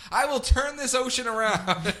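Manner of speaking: high, laughing dad threat; high, laughing warning; mock parent threat.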